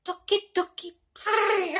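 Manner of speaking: tiny squeaky-high voice, breaking off. Shown as a demonstration. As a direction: attempt a very high voice, failing. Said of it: "I can't do the voice".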